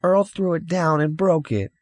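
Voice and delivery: put-on high boy's complaint; bitter child. A narrator's reading. Boy glaring at brother while telling on him, bitter child voice. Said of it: "glared at his brother bitterly".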